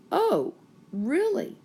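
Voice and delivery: high, disbelieving "Oh, really?"; surprised question. Acted. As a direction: sound truly taken aback. Acting Elijah's quoted line.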